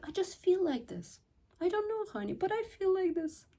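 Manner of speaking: put-on high soft girlish plea; feminine energy voice. Shown as a demonstration. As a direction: disarm him sweetly. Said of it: "I just go back into my feminine energy".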